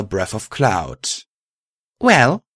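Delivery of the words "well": high, loud prompt; impatient demand. A narrator's reading. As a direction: prod sharply for an answer.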